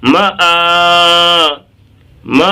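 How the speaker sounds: short ma, then a long held aa in ما; stretched vowel. Shown as a demonstration. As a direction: sustain the aa at the end of ما. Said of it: "ہمیں یہ کھینچنا ہے".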